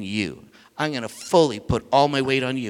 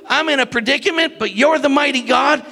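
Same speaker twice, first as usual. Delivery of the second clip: fervent preacher's cry; preaching at full force; 9 semitones higher than usual. Acted. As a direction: drive it home to the congregation. Preacher paraphrase at peak.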